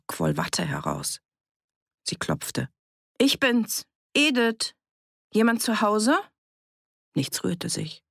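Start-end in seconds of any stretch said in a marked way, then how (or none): 3.2-6.2 high-pitched call through a door; raised, questioning hail. A narrator's reading. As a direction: call to whoever is inside. Calling through a locked door.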